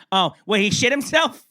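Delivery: high, loud mock mom voice; imitated mother. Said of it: "And then my mother goes".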